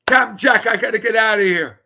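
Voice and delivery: high, loud hail across the room; loud cheerful call to a guest. Real, not acted. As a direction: call him over cheerfully. Host calling out to guest.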